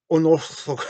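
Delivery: put-on a first try at an Elvish accent, cut off. Host trying the word in an Elvish accent. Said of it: "like, an Elvish accent".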